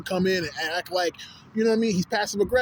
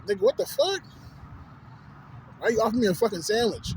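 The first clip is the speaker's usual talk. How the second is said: high-pitched, baffled protest; indignant disbelief; 4 semitones higher than usual. Retold or imitated. Man retelling his reaction to an offered sandwich, indignant. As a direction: question it in mock outrage, pitched up.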